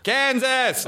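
high-pitched American holler; shouted American. Shown as a demonstration. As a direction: shout it across vast open plains. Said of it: "you have to shout".

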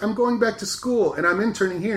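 high, bubbly mom imitation; chirpy mom voice. Mother announcing she's interning at her daughter's station, bright and high. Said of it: "Her mom's like".

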